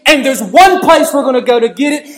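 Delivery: loud, high-pitched revival preaching; fiery preaching. Acted. Preacher confronting the congregation, at full force.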